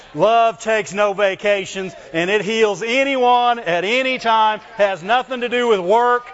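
loud, high preaching. Acted. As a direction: preach it with fire. Preaching at full force.

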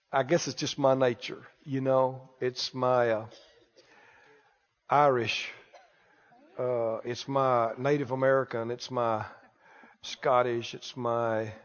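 low, soft, hesitant mock excuse; mild excuse-making. Church member excusing himself to Brother Keith, mild excuse-making. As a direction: lightly imitate someone sheepishly explaining themselves away.

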